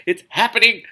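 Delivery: high, loud excited repeat; thrilled squeal. Real, not acted. Reader thrilled the plot is happening, squealing. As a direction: squeal it with delight.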